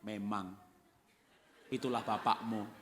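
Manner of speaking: low, mock-knowing agreement; sly agreement. Mother running down papa to child, low knowing agreement.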